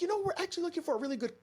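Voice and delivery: high, fast chatty mom voice; imitating a mom. Tourist mom asking for a breakfast spot, high quick chatter. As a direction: rattle it off like an eager tourist.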